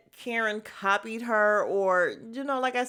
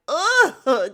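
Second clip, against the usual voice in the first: loud, higher groan of disgust; disgusted ugh; 6 semitones higher, 10 dB louder than usual. Retold or imitated. Viewer watching the creepy flirting, loud disgusted groan.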